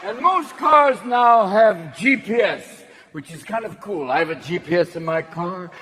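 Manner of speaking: usual speaking voice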